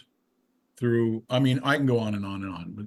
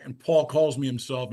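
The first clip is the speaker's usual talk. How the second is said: normal talking voice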